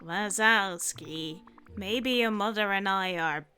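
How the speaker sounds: slow, drawn-out Roz drone; Ross voice. Acted. [name]'s Roz voice teasing Mike slowly. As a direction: drag every word like Roz. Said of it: "let me get my best Ross voice going again".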